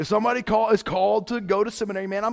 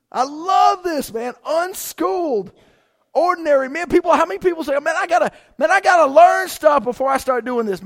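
fired-up preaching; 9 semitones higher, 8 dB louder than usual. Acted. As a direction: shout your joy over the text. Excited preaching, high and loud.

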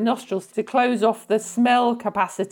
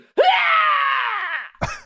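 high drawn-out "hiya" shriek. Shown as a demonstration. Demonstrates a noise as new surname.

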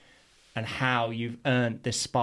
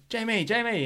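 high calling of a name; 10 semitones higher than usual. Retold or imitated. Old friend calling from his van, higher. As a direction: call him across the street. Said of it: "was like".

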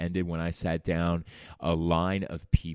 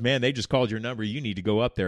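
urgent urging; 4 semitones higher, 6 dB louder, faster than usual. Retold or imitated. Neighbor urging.

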